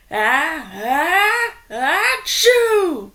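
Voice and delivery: high, loud, slow ah-ah then achoo; a whole crowd's wild sneeze; acted big sneeze. A narrator's reading. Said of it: "repeated the fans".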